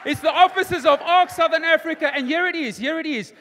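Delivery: loud, high, triumphant testimony; full-force preaching. Real, not acted. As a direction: proclaim seven years of growth to the congregation.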